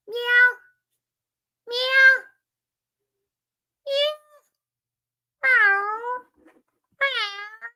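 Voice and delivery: high, drawn-out playful meowing; teasing the chat with high cat meows; mock cat meows. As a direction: meow like a cat as a joke roar. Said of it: "y'all ready for my roar?".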